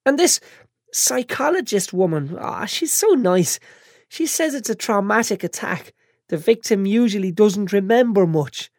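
put-on high, tearful, whiny girl; a bandaged girl fighting tears; whiny young woman. Chantal voiced.

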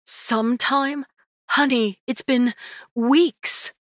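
disbelieving, pleading; exasperated mother. Acted.

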